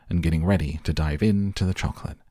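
usual speaking voice

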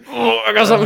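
high put-on hurt dad; mock Tim Allen. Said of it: "he's like".

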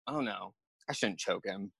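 soft, low inner voice; quiet realization. Retold or imitated. Fighter thinking mid-choke, quiet low aside. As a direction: drop to a hushed second thought.

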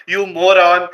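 ordinary talk

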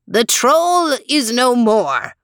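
slow, high proclamation; shouting. Acted. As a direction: announce it triumphantly. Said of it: "shouted Falso".

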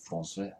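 "Français" said the French way, nasal "an"; "Français" with a French nasal vowel and soft final; French pronunciation. Shown as a demonstration. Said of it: "in French".

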